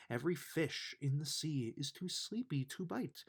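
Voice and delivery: plain reading voice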